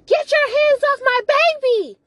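high, loud retold shriek; dramatised high-pitched yell; acted angry outburst. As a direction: shriek it as an outraged mother's warning. Acted outburst at woman touching stomach.

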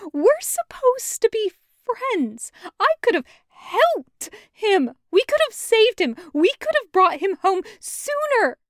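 loud, high, hurt shouting; betrayed friend yelling; shouting through hurt; anguished anger. Acted. Loop grieving and furious.

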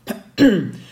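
loud throat clear from pollen. Real, not acted. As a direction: clear your throat, a bit irritated. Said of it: "ik heb een beetje last van de pollen".

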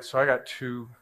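usual speaking voice

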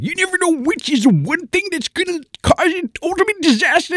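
very high, loud mad scientist voice; squeaky eccentric inventor introduction; mad professor voice. Acted. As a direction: announce yourself like a zany cartoon professor. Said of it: "Professor Shack said".

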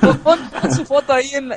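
high laughing insistence. Real, not acted. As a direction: push it through real laughter.

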